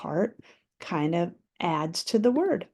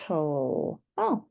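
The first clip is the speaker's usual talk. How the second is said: normal talking voice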